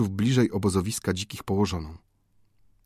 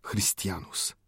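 much softer, spent voice; barely audible; 3 semitones lower, 10 dB quieter, slower than usual. A narrator's reading. Rescued prisoner answers, exhausted and much softer. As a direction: breathe the word out from exhaustion. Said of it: "głos jego skutkiem wyczerpania był ledwo dosłyszalny".

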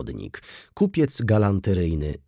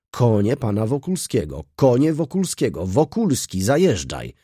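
repeated calling, climbing higher and louder; coachmen's relayed call; 4 semitones higher, 4 dB louder than usual. A narrator's reading. Waiting coachmen relay the call for the horses, building shouts. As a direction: pass the call along, building as it goes. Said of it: "powtórzyli stojący furmani".

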